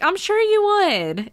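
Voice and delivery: high, slow sarcastic drawl; sweetly sarcastic, dragging it out. Retold or imitated. Artist mocks the lady who wants turtles, sarcastic. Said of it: "in the most, like, sarcastic way".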